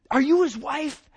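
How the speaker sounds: high, sharp accusing question from a stranger; angrier woman. Retold or imitated. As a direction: demand it like a suspicious stranger. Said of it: "then she's got even angrier".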